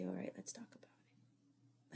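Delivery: soft whispered invitation; gentle whisper. Retold or imitated. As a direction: whisper it tenderly to the child. Mother gently invites talking.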